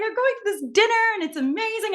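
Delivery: high-pitched, quick excited gush. Retold or imitated. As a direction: gush it fast, pitched well up, thrilled. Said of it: "I was so excited".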